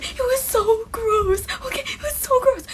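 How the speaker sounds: high, slow whine of disgust, near tears; disgusted wail. Real, not acted. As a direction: wail it like you can't bear the memory. Recalling touching bird guts.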